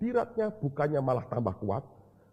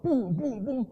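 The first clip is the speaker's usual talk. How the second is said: retold shout; much slower than usual. Someone in the crowd crying out as people fall, high mock yell. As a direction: yelp out the crowd's cry, then the bumps. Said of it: "Ada orang teriak".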